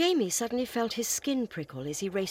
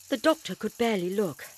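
plain reading voice

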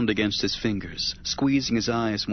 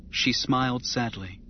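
usual speaking voice

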